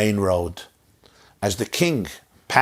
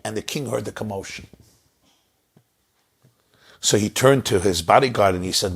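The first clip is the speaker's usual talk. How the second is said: usual speaking voice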